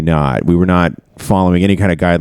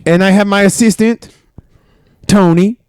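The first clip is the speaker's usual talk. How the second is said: put-on Italian chef accent; 11 semitones higher than usual. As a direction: play a proud Italian chef in a thick Italian accent. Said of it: "start an Italian accent".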